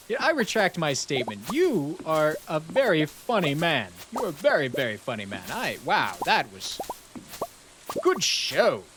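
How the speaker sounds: amused gent voice. Acted. Streamer to chat, praising the factorial joke in a high put-on gent voice. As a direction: play a delighted old gent conceding the joke.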